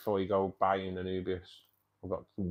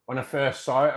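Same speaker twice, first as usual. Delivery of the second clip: ordinary talk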